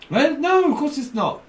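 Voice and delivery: high, loud mock protest; indignant denial. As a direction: burst out high, correcting them. Acted indignant reply in skit.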